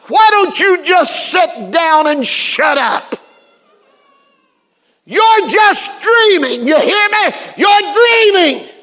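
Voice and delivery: high, slow, drawn-out shout; angry heckler's yell. Retold or imitated. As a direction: yell it like a cynic heckling a preacher. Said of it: "And he yelled out".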